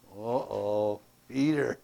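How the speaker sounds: ordinary talk, nothing marked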